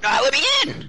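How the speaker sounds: high frantic plea; panicked yelp. Real, not acted. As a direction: shriek to be let in. In-game panic.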